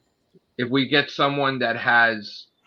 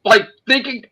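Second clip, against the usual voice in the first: much higher, loud, near-crying shout; furious rant; 12 semitones higher, 4 dB louder than usual. Real, not acted. Raging at the investigator who called him a thief, shouting. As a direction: yell it out, voice cracking with outrage.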